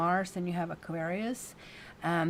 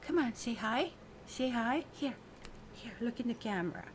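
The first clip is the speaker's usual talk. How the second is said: coaxing pet; 4 semitones higher, 5 dB quieter than usual. Real, not acted. Coaxing pet toward camera.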